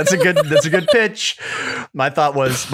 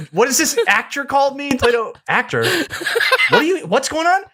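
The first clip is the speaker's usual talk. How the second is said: imitating confused Nick; 13 semitones higher than usual. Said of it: "He's like".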